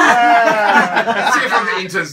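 loud, high, slow laughing call; laughing shout. Real, not acted. Presenter laughs loudly.

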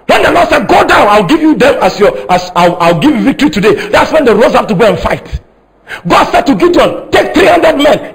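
high-pitched, forceful sermon climax; full-force preaching. Real, not acted. Preacher urging the congregation to obey what they hear from God, impassioned full-force preaching. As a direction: drive each point home like a fired-up preacher.

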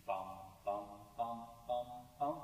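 each note a short bump with body; bouncing note imitation. Shown as a demonstration. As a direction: sing out round, separate "bum" pulses. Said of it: "It has a body".

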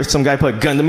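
usual speaking voice

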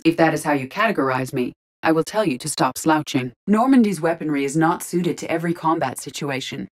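ordinary talk

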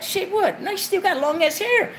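high, puzzled homegirl squeal. Retold or imitated. Homegirl misunderstanding the gossip, high puzzled squeal. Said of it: "homegirls that say".

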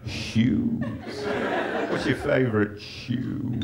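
slow, breathy, reverent "Shoes"; lightly mocking proud shop assistant; proud shoe salesman. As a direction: savour the word like a connoisseur. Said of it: "You didn't see his face as he went".

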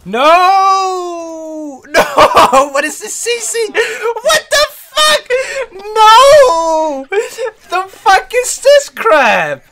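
high, loud laughing-crying shriek; shrieking in frustration. Real, not acted. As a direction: squeal in outrage at the game. Frustrated gamer shrieking at the game.

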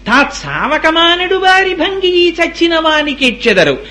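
high, slow chanted verse; verse recitation. Acted. Preacher quotes verse on weeping for the dead, high chant. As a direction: hold the high note through the line.

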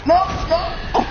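sudden high-pitched dismay; dismayed cry. Real, not acted. Streamer seeing his attack fail, high dismayed cry. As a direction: protest as the hit lands. Boss fight going wrong.

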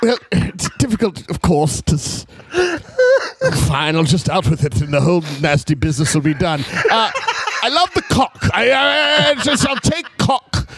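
put-on lightly done posh English accent; hint of a fussy English gentleman. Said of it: "let me hear you do an Englishman".

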